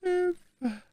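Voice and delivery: faint, very high plea; groaning for help. A narrator's reading. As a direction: let the cry die away softly. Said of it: "ächzte Madame Bonacieux". (schwach und hoch geächzt)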